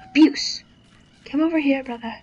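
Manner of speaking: loud mock-menacing call; loud taunt. Real, not acted. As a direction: yell it at the enemy.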